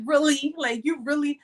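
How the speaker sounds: frustrated God. Retold or imitated. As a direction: imitate someone who can't believe what they see. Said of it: "even God was getting frustrated with me".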